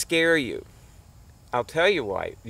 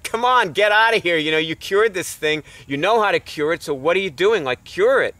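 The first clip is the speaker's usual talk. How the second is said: exasperated, louder push to get on with it; irritated; 5 semitones higher, 6 dB louder than usual. Retold or imitated. To a friend whose lupus came back, irritated scolding. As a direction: scold a friend who should know better, loud and impatient. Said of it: "I just got irritated with her".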